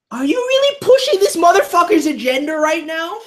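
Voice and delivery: yelling way up high and loud in outrage; furious shout. Real, not acted. Reacting to the villain's scheme, a furious yell. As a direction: blast it at the screen, fully enraged.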